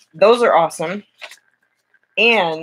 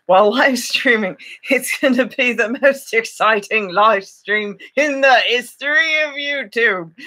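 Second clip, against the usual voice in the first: mock hype; 5 semitones higher than usual. Mock hype about boring stream.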